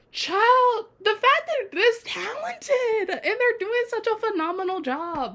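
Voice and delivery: very high squealed praise; high-pitched fan rave; gushing. Real, not acted. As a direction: gush in a squeal, easing down at the end. Gushing over the young actors.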